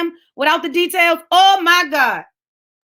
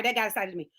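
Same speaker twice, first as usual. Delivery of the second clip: ordinary talk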